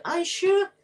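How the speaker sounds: high, startled Scottish agreement; surprised aye. Retold or imitated. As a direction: chirp it out, caught off guard.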